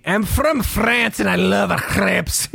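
high, slow, hammed-up French accent; mock French accent. Host posing as crepe-loving French Brian, mock French accent. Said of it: "how they talk".

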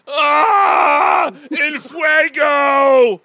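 high, slow screaming cry; burning scream. Pretend. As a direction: shriek like flames are rising. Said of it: "if it was burning".

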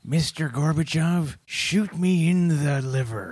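slow, grand mock Reagan speech; hammed-up presidential address; Ronald Reagan impression. Co-host voices Reagan addressing Gorbachev, slow and grand.

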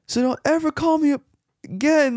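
high-pitched mock rude caller. Retelling the rude caller's parting shot, high mock voice. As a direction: squeak it like a nasty stranger on the phone. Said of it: "he was being rude".

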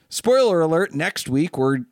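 spoiler alert called out high and loud; a playful, excited tease for listeners; teasing announcement. Real, not acted. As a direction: build hype for next week with a sudden high burst. Teasing next week's episode.

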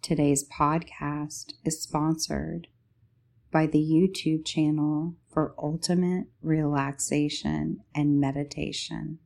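ordinary talk

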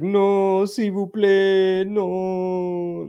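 put-on high, slow pleading French accent; mock French accent. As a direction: plead like a hammy French woman. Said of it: "She was just like".

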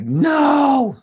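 high, drawn-out cry of warning; acted high-pitched long warning; inner warning voice. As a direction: wail it like a voice in the head. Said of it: "I hear a voice in my head".

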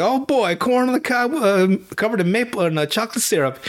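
mock delighted gasp over food; cartoon glee. Teller voicing Shaggy at the buffet, mock cartoon glee.

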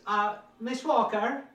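high, loud slow call up the stairs; calling upstairs. Acted. Housekeeper calling upstairs to the maid, loud and high. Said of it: "Lottie comes to the stairs and goes".

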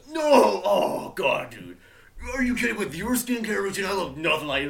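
high, loud bro voice; bro demon. Acted. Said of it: "he goes".

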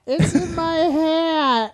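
high, slow fake wail; mock crying promo. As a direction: whine it like a weeping wrestler. Joins mock promo, whining.

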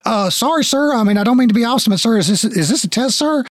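high, rapid-fire protest to a superior; flustered guard. Acted. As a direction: babble nervously at the boss in a high, hurried voice. GM plays flustered guard apologizing.

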